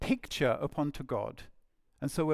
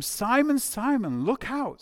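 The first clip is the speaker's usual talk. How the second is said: raised, pressing call of warning; urgent warning; 6 semitones higher, 4 dB louder than usual. Acted. Jesus warning Simon about Satan, urgent and raised. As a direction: call out to alert a friend, pitched up.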